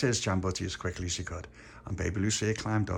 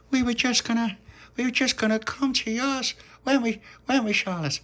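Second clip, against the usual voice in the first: put-on high-pitched, excited little-kid voice; eager child character; 3 dB louder than usual. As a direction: chatter high and eagerly, stumbling over repeats. Child character addressing Dolores and Charlotte.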